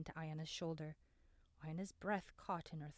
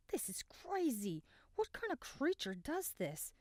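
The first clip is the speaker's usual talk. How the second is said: higher, slower and strained with pain; gasping in pain; 8 semitones higher than usual. A narrator's reading. Cale, just stung, gasps in pain to Abram. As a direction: push the words out through the sting. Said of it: "Cale gasped".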